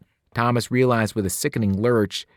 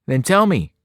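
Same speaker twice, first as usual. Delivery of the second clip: calling out a demand, raised and strained; 6 semitones higher, 6 dB louder than usual. Acted. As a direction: Thomas calls out to Albie, pressing for answers. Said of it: "Thomas called out".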